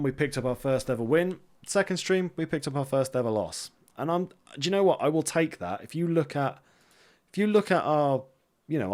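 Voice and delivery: ordinary talk, nothing marked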